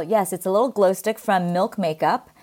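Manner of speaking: ordinary delivery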